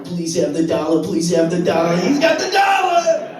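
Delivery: loud plea building to a high cheer; begging turning triumphant. Acted. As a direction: plead hopefully, then explode with excitement. Acted hopeful plea, then triumphant cry.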